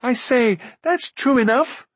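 high-pitched, eager call; high princely call. A narrator's reading. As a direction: call out in lofty agreement. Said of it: "called Prince Rune".

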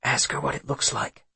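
hushed, voiceless whisper. A narrator's reading. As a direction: whisper it into someone's ear. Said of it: "Will whispers".

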